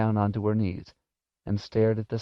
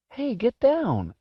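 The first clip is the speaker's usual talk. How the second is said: put-on high, drawn-out boy's call; alarmed boy ordering a pet down; calling out sharply; slower than usual. A narrator's reading. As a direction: call it out high to shoo a cat. Boy orders cat off stove.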